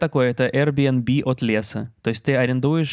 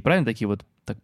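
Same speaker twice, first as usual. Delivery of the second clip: ordinary talk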